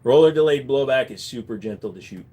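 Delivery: ordinary talk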